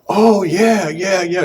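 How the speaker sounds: high, loud burst of recognition; light up all at once remembering; sudden excitement. Retold or imitated. Dying father recalling communion, suddenly excited. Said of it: "His eyes got real big".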